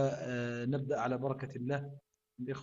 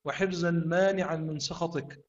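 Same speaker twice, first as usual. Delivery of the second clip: fervent supplication, raised and sustained; prayer; 4 semitones higher, 5 dB louder than usual. Acted. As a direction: pray earnestly to God. Closing prayer after Quran recitation.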